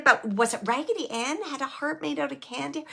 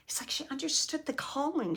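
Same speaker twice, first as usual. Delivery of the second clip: hushed; 3 semitones higher, 7 dB quieter than usual. Real, not acted. Medium passing on spirit's message.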